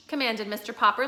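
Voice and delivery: plain reading voice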